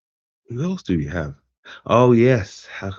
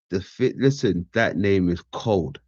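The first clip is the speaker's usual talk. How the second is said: normal talking voice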